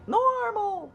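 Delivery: very high mock refrain; hammed-up high-pitched reassurance; mimicking dismissive friends. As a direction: chirp the brush-off way up high.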